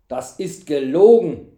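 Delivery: very loud, forceful accusation; angry accusation. A narrator's reading. Knight shaking lying servant, angry accusation. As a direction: slam the liar with it.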